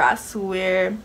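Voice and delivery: ordinary talk, nothing marked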